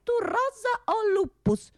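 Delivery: high put-on quizzing voice; mock schoolmaster. Teasing the Latin speaker, high put-on voice. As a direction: quiz him in a silly high voice.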